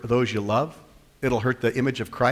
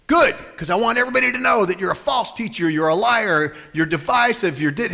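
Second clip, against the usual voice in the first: retold angry shout; 8 semitones higher than usual. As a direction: hurl the accusations like the angry congregant. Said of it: "You're shouting".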